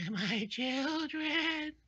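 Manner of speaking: high, drawn-out eerie witch voice; witch cackle. Acted. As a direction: wail it slowly like a storybook witch. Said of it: "hear the cackle of the".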